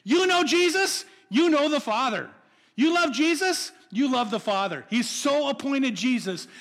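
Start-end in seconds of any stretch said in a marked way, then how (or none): 0.0-4.8 high, loud preacher's call; fervent preaching. Acted. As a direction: preach it out with full conviction. Preacher opening at full force.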